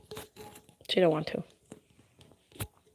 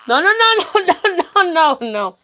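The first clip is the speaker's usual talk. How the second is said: playful high no; 9 semitones higher, 5 dB louder than usual. Real, not acted. Baby grabbing things, high loud.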